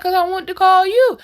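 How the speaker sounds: retold defensive retort. Father explaining his call to her, defensive.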